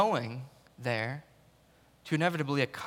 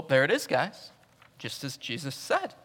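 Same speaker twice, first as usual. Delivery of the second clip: usual speaking voice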